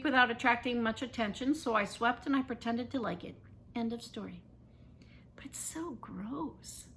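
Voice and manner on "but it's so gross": slow breathy whisper; hushed disgust. A narrator's reading. Boy whispering his disgust at the sweeping job. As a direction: breathe it out grossed out.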